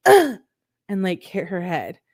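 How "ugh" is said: loud high fed-up ugh, imitating the girlfriend; mock a furious groan of frustration; frustrated groan. Showing how the girlfriend groaned before hitting her head, loud frustrated ugh. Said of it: "and she was like".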